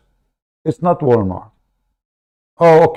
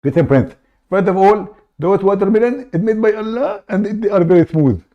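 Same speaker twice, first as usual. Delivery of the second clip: raised, pushy put-on caller voice; fake caller voice; 7 semitones higher than usual. Prank caller cutting into the host's show, high put-on voice. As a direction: barge in as an eager fake caller.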